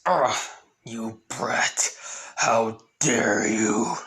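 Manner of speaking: slow whispered snarl after a grunt; groan, then seethe slowly through the pain; wounded, outraged villain. Acted. Voicing villain stabbed in leg.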